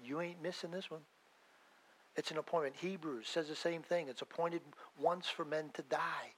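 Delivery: normal talking voice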